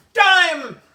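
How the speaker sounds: loud, high eureka shout; excited discovery. Acted. AJ finding a dime in his pocket, loud excited cry. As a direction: shout it like you found treasure.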